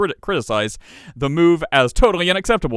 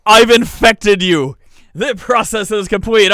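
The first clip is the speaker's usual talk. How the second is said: high, loud, drawn-out announcement; mock triumphant proclamation; 7 semitones higher, 7 dB louder, slower than usual. Host teases co-host about catching his mixed-up words, mock triumphant. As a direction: proclaim it slowly, like a gloating villain.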